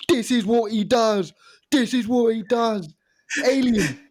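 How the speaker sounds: high, raving studio pundit; pundit impression. Pundit on BT raving about Ronaldo's goal. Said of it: "the spoil was in the studio".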